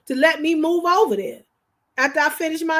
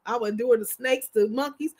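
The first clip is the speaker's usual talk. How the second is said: usual speaking voice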